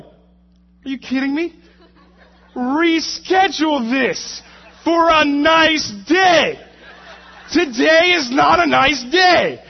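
loud, high, drawn-out indignant shouting; hammed-up furious protest, word by word; mad outburst. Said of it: "And I get mad".

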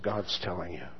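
to the friend on the phone, overwhelmed and near tears; tearful. Retold or imitated. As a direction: break down as you say it. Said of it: "broke down and started crying".

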